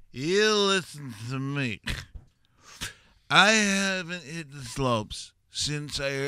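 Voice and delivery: put-on slow, high, grand old blowhard; pompous old man. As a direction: lay it on like a vain old champion. He plays pompous old skier.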